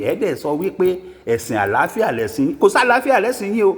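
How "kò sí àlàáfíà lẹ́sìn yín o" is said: high, fast, emphatic rebuttal; heated denial. Real, not acted. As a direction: fling the denial out, raised and quick. Heated rebuttal in polemical monologue. (fífi ìbínú sọ̀rọ̀)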